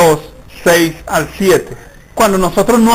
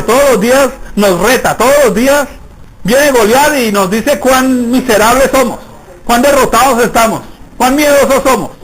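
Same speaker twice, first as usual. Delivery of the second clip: rising preaching; 4 semitones higher than usual. Acted. Preacher stirring the congregation, rising in force.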